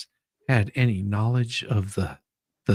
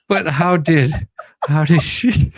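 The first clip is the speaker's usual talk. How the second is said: louder, higher question breaking into laughter; laughing; 6 semitones higher, 7 dB louder than usual. Real, not acted. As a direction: try to ask but keep cracking up. Lawyer cracking up mid-question.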